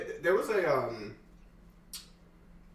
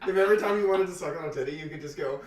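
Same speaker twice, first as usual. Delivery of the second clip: mock baby wail; 3 semitones higher, 6 dB louder, faster than usual. Mock baby crying shout.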